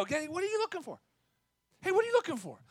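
high, soft, friendly question; lifted, light inquiring voice; gentle, inquisitive. Acted. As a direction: ask with kind, open curiosity. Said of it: "There's this, this inquisitive".

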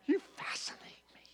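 hushed, airy whisper to a spouse; mock whisper. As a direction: whisper it like a sweet compliment. Models remark to a spouse.

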